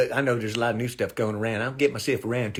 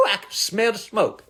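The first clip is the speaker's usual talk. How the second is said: ordinary talk, nothing marked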